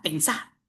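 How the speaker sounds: pensá ending on a stressed A; stress on final syllable. Shown as a demonstration. Teacher wrapping up the example, pensá stressed on final A. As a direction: hit the last syllable of pensá, softly. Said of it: "Tienes que tildar la A".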